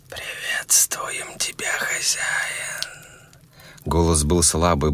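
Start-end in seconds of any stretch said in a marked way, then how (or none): 0.1-2.7 put-on dying old man's whisper; very old faint voice. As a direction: breathe it out like a dying old man. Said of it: "будто шёпот умирающего старика".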